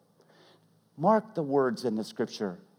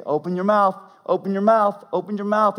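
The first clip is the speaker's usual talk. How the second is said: higher, louder insistent repeats; urgent coaxing call; 7 semitones higher, 6 dB louder than usual. Retold or imitated. Officer urging the choking little girl, loud and urgent.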